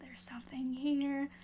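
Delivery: whispered secret, very soft; conspiratorial whisper. Retold or imitated. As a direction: lean in and whisper it. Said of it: "I'm like".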